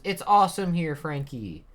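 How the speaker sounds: high, loud and enthusiastic; excited girl. Acted. Bonnie tells Frankie she likes it here, excited.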